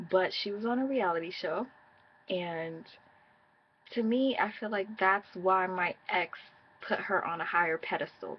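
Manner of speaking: ordinary delivery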